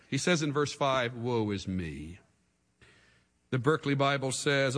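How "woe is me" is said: quiet lament. Acted. Isaiah's lament.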